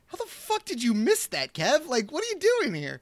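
high-pitched, exasperated disbelief; mock incredulous scolding. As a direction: call the director out in playful outrage. Imagined scolding of the director.

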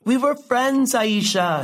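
high-pitched, pressing reminder; hurt insistence. Acted. Old friend reminds the successful one of their friendship, hurt insistence. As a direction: press the point, pitched well up.

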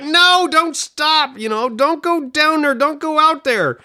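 high, slow drawn-out cries of no; mock pleading shout. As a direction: beg the screen heroine to stop, hammed up. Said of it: "And everyone's like".

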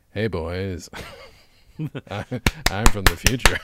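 cool, low-key Rufus impression; Carlin as Rufus. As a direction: imitate Carlin greeting the boys as Rufus. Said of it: "I played Carlin".

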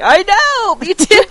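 high, loud eager reply; excited agreement. Retold or imitated. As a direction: agree with a burst. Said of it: "I'm like".

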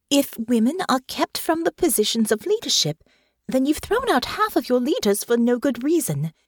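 put-on young noblewoman finding her voice; earnest young Honor. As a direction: argue it out eagerly. Honor continues her argument.

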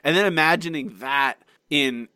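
normal talking voice

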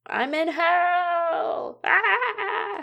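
high, drawn-out cry of torment; anguished scream. Acted. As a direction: wail like a soul in hell. Screaming in Latin, horror ship.

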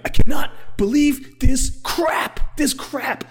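squeaky, drawn-out mock outrage; mock Lindsey Graham. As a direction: lampoon a pompous senator in disbelief. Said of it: "Lindsey Graham sitting here going".

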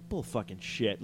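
low, very soft scoff; muttered disbelief. Retold or imitated. Horror viewer doubting the fit actor, muttered disbelief.

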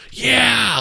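high, loud imitated cheer; excited cheer. Game master imitating the players cheering a double twenty, excited shout. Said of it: "like shouts of excitement".

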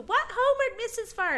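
put-on very high surprised kid voice; child voice. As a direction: squeak it like a shocked student. Kids voiced in story.

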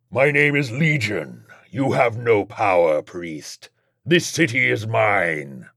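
slow, drawn-out demonic menace; imperious demon voice; menacing demon Legion. Acted. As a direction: lord it over the priest, slowly.

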